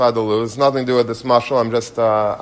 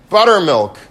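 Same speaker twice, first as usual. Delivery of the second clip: sudden loud higher outburst of one word; blurted out; 6 semitones higher, 6 dB louder than usual. Retold or imitated. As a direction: blurt it out across the gallery. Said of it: "this guy in the tour says".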